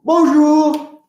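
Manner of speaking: bonjour called out high and loud, French style; bright high bonjour for the class to copy; bonjour modelled. Shown as a demonstration. Teacher to class, modelling bonjour high and loud. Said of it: "Say bonjour".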